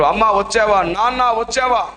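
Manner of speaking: put-on a boy's hopeful call for అమ్మ and నాన్న. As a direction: call out hoping mother has come. Boy calling out for parents.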